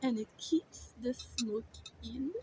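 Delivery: normal talking voice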